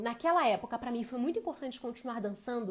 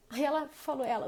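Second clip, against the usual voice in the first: ordinary delivery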